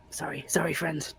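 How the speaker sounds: soft breathy sorry; whispered apology. Real, not acted. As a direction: whisper it sheepishly. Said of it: "whispered apology to cat".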